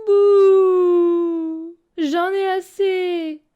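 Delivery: high, loud sobbing wail; wailing between sobs. A narrator's reading. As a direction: blubber it out, slow. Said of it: "entre deux hoquets".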